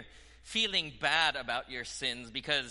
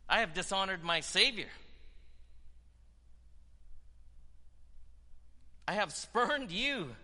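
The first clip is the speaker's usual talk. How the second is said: much higher, louder pleading cry to God; impassioned prayer; 11 semitones higher, 5 dB louder than usual. Acted. Preacher voicing a believer crying out to God, impassioned preaching prayer. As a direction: pour it out to the Lord at full force.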